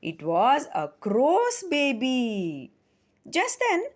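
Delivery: usual speaking voice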